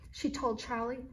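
usual speaking voice

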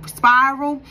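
ordinary delivery

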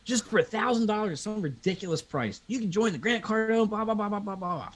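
pushy salesman. Retold or imitated. Sales rep pushing pricey program.

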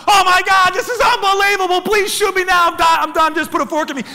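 high, loud, giddy disbelief; hammed-up ecstatic surrender; overwhelmed excitement. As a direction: cry out as if it's all too much. Acts out being overwhelmed.

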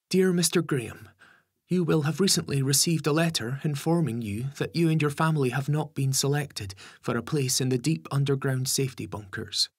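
put-on high girl's voice reading a formal letter; Charlotte reading aloud. Charlotte reading the letter to her family, high and formal.

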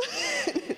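high bright laugh. Real, not acted. Laughing at her own pleading, bright laugh. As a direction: laugh at yourself.

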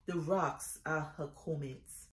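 soft, low closing line of a poem; hushed poem refrain. Acted. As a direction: let the refrain sink to a quiet, low close. Poem refrain repeated softly at close.

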